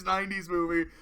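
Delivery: normal talking voice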